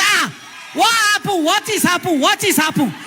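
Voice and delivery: high, shouted pidgin questions; rough Yoruba men. Retold or imitated. Yoruba drivers rushing in to the quarrel, shouting high. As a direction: bark out what is happened like rowdy drivers.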